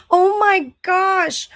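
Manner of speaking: high, loud laugh into a slow oh my gosh. Real, not acted. Delighted by a viewer comment, laughing loudly. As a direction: laugh it out, drawn out.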